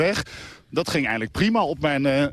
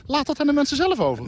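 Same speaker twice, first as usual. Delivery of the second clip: indignant; 12 semitones higher than usual. Real, not acted. Arguing against road closure.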